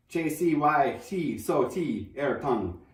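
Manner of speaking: slow sounded-out Chinese phrase; mock Chinese. Shown as a demonstration. Narrator shows how the Chinese sounded, slowly. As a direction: read "J C Y T so T air tongue" slowly as Chinese syllables. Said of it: "It sounded like this".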